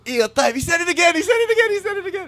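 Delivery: excited shout. Retold or imitated. Friends excited at the party.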